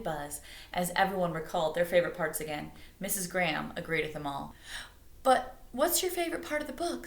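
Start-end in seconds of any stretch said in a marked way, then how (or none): 5.2-7.1 put-on higher, curious girl voice; Stella asking. A narrator's reading. Stella asking Miss Graham, lightly voiced. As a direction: ask eagerly. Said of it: "Stella asked her".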